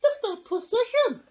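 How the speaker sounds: put-on very high, loud girlish cry; high, loud thrilled child; excited shout. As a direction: call out gleefully like a little girl. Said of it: "shouted Kath".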